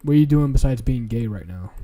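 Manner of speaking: ordinary talk, nothing marked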